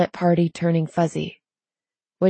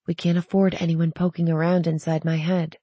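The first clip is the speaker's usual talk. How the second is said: ordinary delivery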